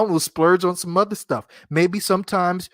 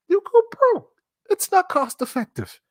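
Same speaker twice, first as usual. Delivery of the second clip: "You'll go broke." squeaked much higher; high squeal. Shown as a demonstration. Host warning buying precut pork costs too much, high squeal.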